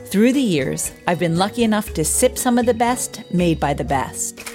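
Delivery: ordinary delivery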